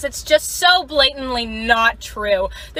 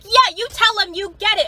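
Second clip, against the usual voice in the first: higher, excited cheering; egging on; 7 semitones higher than usual. Retold or imitated. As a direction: cheer her on from the side. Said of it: "And I'll be like".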